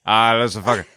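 lightly caricatured Pikey brogue; mock Pikey exclamation; Pikey accent. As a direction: put on a comic Pikey accent. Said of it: "A little Pikey".

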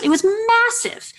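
amazed. Real, not acted. Awed retelling of giant UFO.

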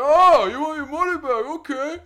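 high, loud sneering yell; mock angry shout. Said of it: "he's like".

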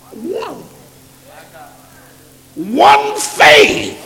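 high, loud shouted scripture; full-force preaching. Real, not acted. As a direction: drive each word out to the congregation.

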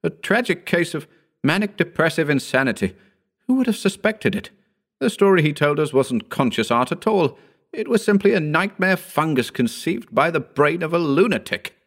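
high-pitched, dismissive verdict; pronouncing Howard. Acted. Howard dismisses Wells as mad.

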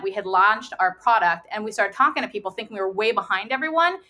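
ordinary delivery

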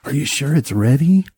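high, anxious-to-please minion; toady voice. Acted. Toady minion questioning the beholder master, servile and high. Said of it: "that toady voice is like".

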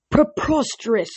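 high, outraged cry; bellowed. Acted. Old satyr dismissing Grover's claim, a high indignant bellow. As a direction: pitch the word up in scorn. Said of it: "Selenius bellowed".

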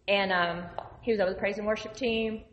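ordinary talk, nothing marked

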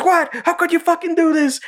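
very high mock outraged yell; high-pitched hammed-up betrayal; imitating Mister Garrison yelling. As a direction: shriek at the kids in a cartoon voice. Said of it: "Mister Garrison's yelling at the kids".

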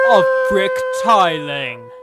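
loud, drawn-out announcer flourish; mock fanfare. Host announcing the celebrity tiling segment, mock fanfare. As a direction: blast it out slowly like a fanfare. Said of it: "That was a fanfare for the celebrities".

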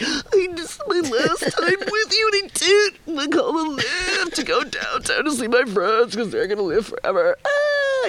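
high-pitched hammed-up wail, words stretched out; imitation of sobbing through the words; mock crying. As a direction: wail it like an exaggerated crybaby. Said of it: "couldn't start crying in front of Matthew".

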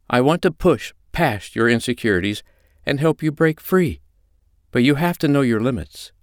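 high-pitched, firm comforting voice; steady them with firm reassurance; reassuring mistress. Acted. China calms a panicking Holly, firm and reassuring.

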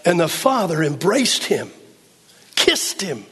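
high, loud, impassioned sermon delivery; full-force preaching. Acted. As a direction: drive it home to the congregation.

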